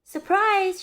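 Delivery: high, loud cheerful shout; happy shout. A narrator's reading. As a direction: shout it back with delight. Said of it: "shouted Amelia Bedelia".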